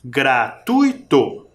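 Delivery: slow GRA-TUI-TO with weight on TUI; stress on TUI. Shown as a demonstration. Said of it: "O som parece que vem mais forte". (Gratuito bem devagar, TUI forte)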